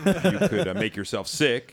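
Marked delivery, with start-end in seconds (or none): 0.1-0.7 laughing. Real, not acted. Laughing at own joke.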